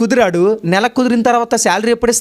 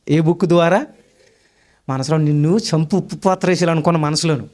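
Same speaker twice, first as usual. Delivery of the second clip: ordinary delivery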